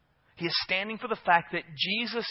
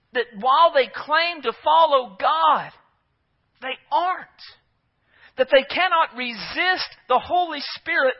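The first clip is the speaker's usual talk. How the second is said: high, forceful pulpit delivery with hard-hit key words; raised, emphatic sermon voice; impassioned preaching; 8 semitones higher, 7 dB louder than usual. Real, not acted. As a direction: drive the point home from the pulpit.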